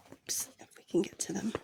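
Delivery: breathy whispered aside. Real, not acted. Teacher muttering an aside to himself in a whisper. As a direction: whisper it under your breath.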